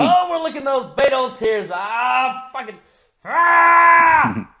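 high, loud victory holler; gloating shout. Real, not acted. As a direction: crow wildly, building to a long scream. Gloating over election win.

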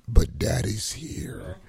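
slow, breathy mock whisper of "Daddy's here". Friend mocking the host's daddy line, slow whisper. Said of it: "just like saying".

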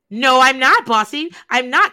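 loud, offended protest; indignant denial. Retold or imitated. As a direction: shoot back loud and defensive. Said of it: "she was like, gasps".

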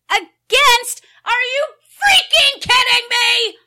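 loud, high, exasperated yell; furious shout. Acted. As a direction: blow up in disbelief. Chair explodes at tied vote.

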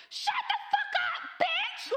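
put-on high, loud squeal of a three-year-old cursing, laughing through it; screeching toddler. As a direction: shriek it like a tiny furious kid. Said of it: "And he's like".

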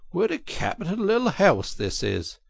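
high, cheery call; cheerily. A narrator's reading. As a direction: call out brightly to cheer him. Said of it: "he called out cheerily".

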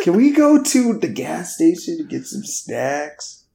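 high-pitched pleading whine, hammed up; mock complaining. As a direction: beg like a pretend nagging tagalong. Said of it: "hear me complain the whole time".

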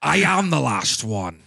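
slow Scottish film-star impression; Sean Connery. Co-host quoting a film for the others, slow Scottish star impression. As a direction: draw it out in a put-on Scottish star voice. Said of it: "Your Sean Connery's not bad".

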